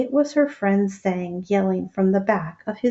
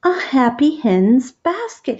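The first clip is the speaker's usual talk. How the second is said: says it again louder, stressing it; patiently raised repeat; 5 semitones higher, 5 dB louder than usual. Acted. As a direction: lift the voice to be heard. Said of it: "he repeated".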